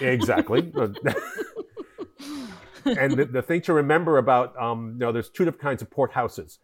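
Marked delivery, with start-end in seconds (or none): 0.8-2.5 high amused laughter. Real, not acted. Guest amused by host's lawless joke, high laugh.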